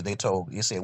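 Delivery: ordinary delivery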